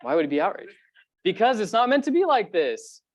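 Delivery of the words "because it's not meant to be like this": higher and louder, driving the point home; a preacher's impassioned cry that death is wrong; emphatic outburst. Real, not acted. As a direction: let it burst out, raised and urgent.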